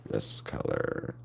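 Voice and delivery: whispered to self. Real, not acted. Muttering while painting.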